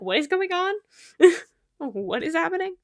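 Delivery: high-pitched baffled question; retold bewilderment. Retelling coming back to find everyone fighting, baffled. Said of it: "And I was like".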